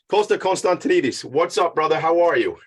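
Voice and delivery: usual speaking voice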